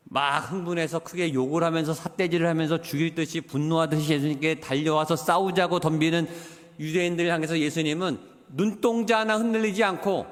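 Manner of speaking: usual speaking voice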